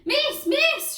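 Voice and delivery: high, loud schoolboy shout. A narrator's reading. Pupil calls for teacher's attention, shouting high. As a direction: call out urgently to the teacher. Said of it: "shouted Inky Ian".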